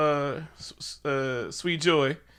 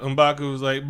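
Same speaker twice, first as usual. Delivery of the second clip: ordinary talk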